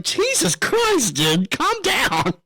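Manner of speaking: hammed-up shocked scolding; mock exasperation. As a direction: play at being appalled. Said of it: "And I'm like".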